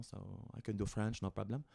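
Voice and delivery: light, playful French accent; a hint of French accent. Shown as a demonstration. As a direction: slip casually into a soft French accent. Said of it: "could do a French accent".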